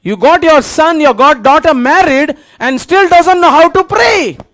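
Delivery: urgent, emphatic preacher delivery; forceful preaching. Real, not acted. Preacher to congregation about praying mothers on a battlefield, forceful preaching.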